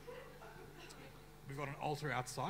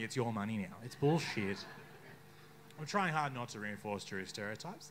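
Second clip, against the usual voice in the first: ordinary talk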